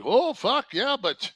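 high, loud fretting; hammed-up panicking wrestler, pitch way up; mock panic. Wrestler imagined panicking about Logan Paul, loud and high.

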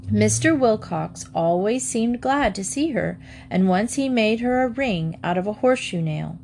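ordinary delivery, nothing marked

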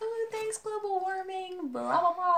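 very high, soft mock gushing; ditzy mock thanks; mocking everybody. People thanking global warming, very high and mocking. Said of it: "everybody's like".

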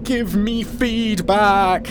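drawn-out, high-pitched loud wail; desperate, very loud high plea; wailing demand. Acted. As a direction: wail it at full volume, stretching each word.

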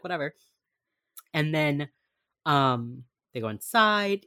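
usual speaking voice

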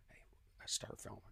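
whispered, secretive; retold hushed aside. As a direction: slip it to a friend under the breath. Retold aside to friend Jay.

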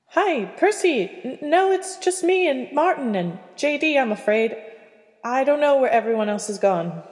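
высокий, бодрый голос Кэти; говори выше, легко и приветливо; young woman voice. Acted. Katie answers Percy.

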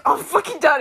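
a loud, retold toddler huff; mock angry outburst. Retelling her little brother storming off, loud mock fury. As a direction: play the tiny brother fed up and loud.

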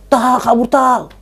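high-pitched acted panic; urgent alarm. Telling friends to flee the apparition, acted urgent alarm. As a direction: urge them onward as if fleeing.

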